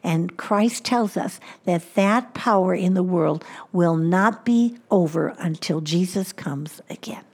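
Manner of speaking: ordinary talk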